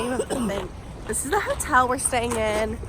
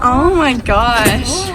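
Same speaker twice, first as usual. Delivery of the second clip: amazed; 7 dB louder, much slower than usual. Real, not acted. Amazed at view.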